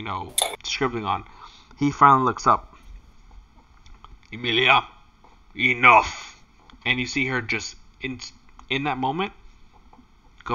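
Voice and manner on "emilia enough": firm, raised voice; stern command. Acted. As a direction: cut her off sharply. Sauron stops Emilia sharply.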